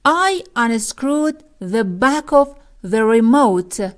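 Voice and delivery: very slow, each word spaced for learners; slow, careful teacher reading; slow model reading. Shown as a demonstration. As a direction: read it out slowly like a teacher.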